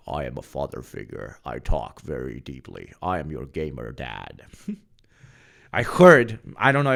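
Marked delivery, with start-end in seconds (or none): 0.1-4.4 a lightly lower, softer fatherly voice; hint of a put-on dad voice; mock deep gamer dad. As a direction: play the self-serious gamer dad, deep-ish and hushed. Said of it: "Matt was always like".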